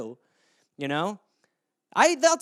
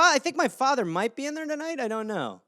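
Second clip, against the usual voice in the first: usual speaking voice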